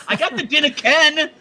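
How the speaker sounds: mock Scottish. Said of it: "for the Outlander speech".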